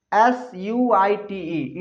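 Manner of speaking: letters spelled out slowly; slow, deliberate spelling for children. Shown as a demonstration. Teacher spells word for class.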